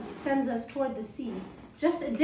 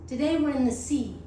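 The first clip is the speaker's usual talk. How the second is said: ordinary delivery, nothing marked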